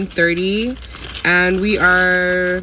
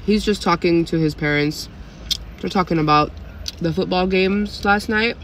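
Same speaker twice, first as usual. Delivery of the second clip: talking between bites; eating breakfast sandwich; mouth full chewing. Real, not acted. Seen 0: Eats sandwich in car, chewing and biting between spoken lines.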